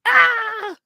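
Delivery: short, very high, loud scream; high-pitched scream of mock panic. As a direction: yelp as if diving from gunfire. Cops hit the deck under gunfire.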